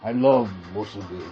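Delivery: lightly voiced grunting crime boss; mild mock yakuza growl; a hint of a gang overlord savoring food. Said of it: "his gang overlord voice".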